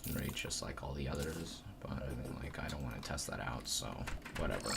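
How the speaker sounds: very soft, half-whispered muttering. Real, not acted. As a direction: mumble it to yourself. Trailing off while playing.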